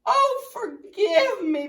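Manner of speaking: high, loud, slow plea; begging. Acted. Tadpole begs caterpillar, high slow plea. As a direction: beg for forgiveness, stretched out. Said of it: "begged the tadpole".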